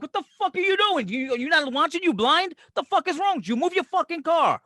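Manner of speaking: high-pitched New York driver's rant; mock outraged tirade at another motorist; road rage. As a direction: berate a bad driver in rapid-fire questions.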